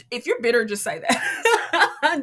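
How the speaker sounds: amused imitation of a blunt commenter; laughing quote. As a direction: draw out the ending while laughing.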